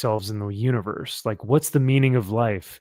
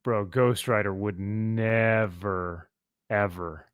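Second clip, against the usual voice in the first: mock dismissive; 5 semitones lower, 6 dB quieter, slower than usual. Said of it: "I'm like".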